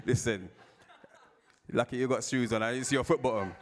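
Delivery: loud teasing jab; joking jab. Retold or imitated. Colleague teases him about ashy feet, loud jab. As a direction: rib him loudly, grinning.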